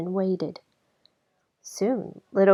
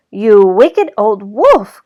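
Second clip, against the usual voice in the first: slow, much louder furious shout; angry cry; 13 dB louder, much slower than usual. A narrator's reading. Woodcutter finds the wolf in bed, furious shout. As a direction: roar it at the villain. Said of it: "cried the woodcutter".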